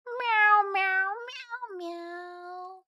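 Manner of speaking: playful high kitty meows; cat meows. Pretend. Streamer meowing at chat, high playful cat voice. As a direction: meow like a cat to greet chat.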